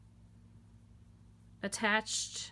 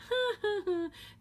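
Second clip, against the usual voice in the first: high little run of laughter; short high-pitched chuckle; wry laugh; 12 semitones higher than usual. Real, not acted. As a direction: laugh at the hopeless idea. Laughs about ordering online.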